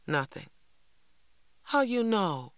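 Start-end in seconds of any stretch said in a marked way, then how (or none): 1.6-2.5 put-on high, challenging child's question; suspicious. A narrator's reading. Little brother doubts older brother's news, suspicious. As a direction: demand it suspiciously, pitched high. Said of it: "asked Little Man suspiciously".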